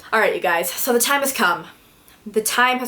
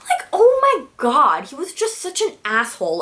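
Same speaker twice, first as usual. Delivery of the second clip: exasperated; 6 semitones higher than usual. Real, not acted. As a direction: drag it out in disbelief. Exasperated at boyfriend's manipulation.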